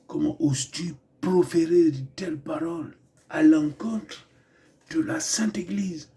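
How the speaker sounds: dubbing the film hero in a put-on African accent, voice dropping to a whisper; mock African accent. Parodying the film's hero while watching, in a mock African accent. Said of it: "je refaisais ses paroles avec un accent, hein, vous savez, l'accent africain".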